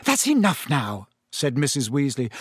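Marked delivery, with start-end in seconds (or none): none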